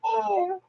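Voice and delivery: tiny high, soft meow; kitten meow. Retold or imitated. Mother imitates her newborn's cry, soft high kitten meow. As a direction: mimic a kitten's faint mewl. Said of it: "it sounded like a kitty cat".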